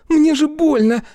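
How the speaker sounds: high, loud cry of pain; pained yelp. Acted. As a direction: yelp as your hair is pulled. Hair pulled, Fiona cries out.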